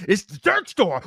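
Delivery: mild mock insistence; insistent. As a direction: state it like the decision is made. Mocking George's stubbornness.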